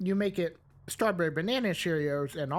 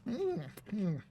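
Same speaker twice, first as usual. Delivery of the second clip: chewing; 6 dB quieter than usual. Real, not acted. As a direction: mouth full of cereal. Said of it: "can hear me chewing".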